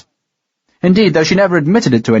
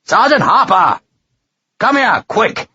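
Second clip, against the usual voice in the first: bellowing; 8 semitones higher, slower than usual. A narrator's reading. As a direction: bellow the name into the night. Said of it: "he bellowed hugely into the night".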